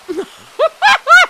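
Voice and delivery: loud, high laugh; sudden high-pitched laughing burst; burst of laughter. Real, not acted. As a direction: crack up at your own joke. Laughing at own joke.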